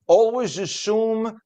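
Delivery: imitating dad with an Italian New York accent. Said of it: "give him an Italian New York accent".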